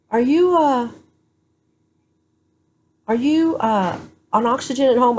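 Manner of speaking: high mock staff question; questioning visitor. Hospital staff asking about home oxygen, high and puzzled. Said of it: "people would come in and go".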